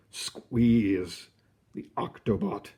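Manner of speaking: ordinary talk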